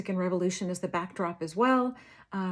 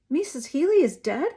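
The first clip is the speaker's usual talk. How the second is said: shocked question; 6 semitones higher, 6 dB louder than usual. A narrator's reading. Girl hears her patient died, shocked. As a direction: voice jumps high and loud in shock.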